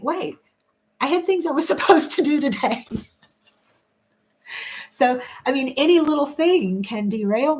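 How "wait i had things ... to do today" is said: high, hurried, laughing alarm; mock sudden realization. Speaker mimicking herself half an hour later, comically alarmed. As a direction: play a comic jolt of remembering. Acting out sudden remembering of tasks.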